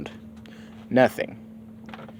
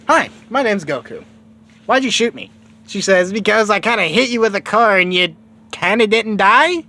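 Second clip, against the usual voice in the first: higher, bright exchange of lines; high cartoon voices; 9 semitones higher, 3 dB louder than usual. Goku and Bulma chatting, both voiced higher. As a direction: play both kids pitched up.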